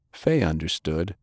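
ordinary delivery

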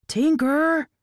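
high, loud call across the truck; shouting a name. Acted. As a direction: yell her name in alarm. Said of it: "Oil Can shouted from the back".

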